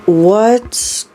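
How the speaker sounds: high, stretched-out anxious voice; alarmed question. Acted. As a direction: ask it in rising worry. Frightened girl interrupted mid-question.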